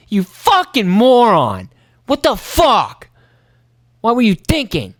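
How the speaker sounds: high, slow, incredulous outburst; exasperated insult. Retold or imitated. Troy silently cursing the clumsy angler, high and exasperated. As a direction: drag out the disbelief at his stupidity. Imagined thoughts of annoyed Troy.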